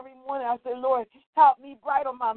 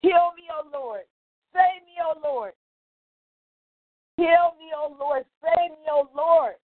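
a touch of a chanted prayer; psalm prayer; 3 semitones higher than usual. Acted. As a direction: plead the psalm to the Lord.

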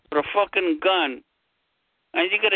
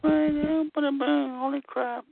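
mock quote; 9 semitones higher, 4 dB quieter, slower than usual. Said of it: "but he was just".

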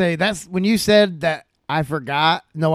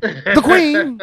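higher mock British voice; mock British accent; 8 semitones higher, 3 dB louder than usual. Host answers in silly British accent. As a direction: announce it like a toff.

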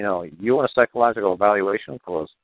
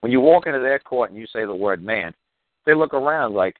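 normal talking voice

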